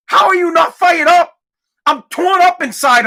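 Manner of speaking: loud, high-pitched incredulous demand; exasperated yell at the audience; real outrage. Real, not acted. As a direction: shout it, fired up. Outraged challenge to listeners.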